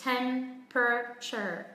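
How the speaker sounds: Temperature in three slow, evenly weighted syllables; very slow, separated syllables in Temperature; no word stress. Shown as a demonstration. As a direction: sound out tem-pruh-cher for Temperature, stressing none. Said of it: "đang đọc không có trọng âm".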